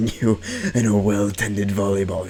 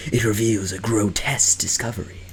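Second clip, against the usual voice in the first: normal talking voice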